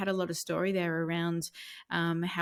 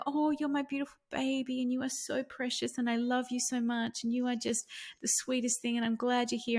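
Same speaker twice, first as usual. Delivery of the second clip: higher, adoring mummy voice; cooing to baby; 5 semitones higher than usual. Retold or imitated. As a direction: coo lovingly as if to a baby in your arms. Cooing to son playing a baby.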